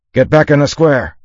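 loud, high battlefield command; shouted order. A narrator's reading. Konoha orders troops back into the square, shouting. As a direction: yell it across the ranks. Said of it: "Konoha shouted".

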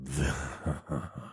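low, soft villain's chuckle; quiet, sinister laugh; menacing laugh. A narrator's reading. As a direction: chuckle menacingly under your breath. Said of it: "Vinra laughed menacingly".

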